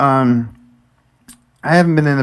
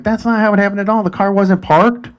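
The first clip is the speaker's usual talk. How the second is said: higher-pitched mock scolding; correcting officer; 6 semitones higher than usual. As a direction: imitate an officer flatly contradicting you. Officer contradicts her account, retold.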